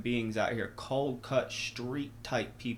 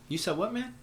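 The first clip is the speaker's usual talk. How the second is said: much higher, startled disbelief; surprised; 9 semitones higher than usual. Retold or imitated. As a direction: shoot up in pitch, caught off guard. Surprised by the request.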